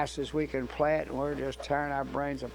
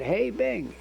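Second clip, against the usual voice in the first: higher-pitched call, only mildly raised; retold holler; 7 semitones higher than usual. Guitarist calling after departing bandleader, mild retold holler. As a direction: imitate calling out after someone walking off. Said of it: "And I hollered".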